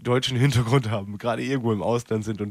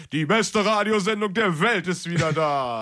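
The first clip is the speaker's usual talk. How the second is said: higher, slow announcer voice for the slogan; grand on-air sign-off; radio announcer sign-off; 4 semitones higher than usual. Acted. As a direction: stretch it out like a station jingle. Host closes with show slogan.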